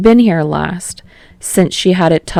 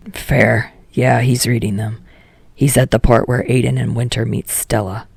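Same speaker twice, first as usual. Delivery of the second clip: put-on a lower, sullen teen voice; Katsuki's voice; 6 semitones lower than usual. Katsuki brushing off Kirishima, in a lower sullen voice. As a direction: drop lower and grumble it out as Katsuki.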